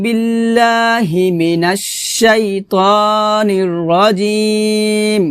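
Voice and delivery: slow, drawn-out tajweed chant; Quran recitation. Shown as a demonstration. Teacher recites the opening before the lesson, slow melodic chant. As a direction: recite it slowly and melodically like the start of a Quran reading.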